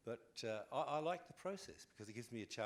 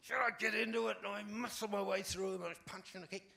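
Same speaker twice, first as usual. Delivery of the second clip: high, loud, physical telling; energetic demo of acting in the booth; acted out with gusto; 12 semitones higher, 9 dB louder than usual. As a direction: punch out every verb like you're fighting the mic. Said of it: "She acts the whole thing".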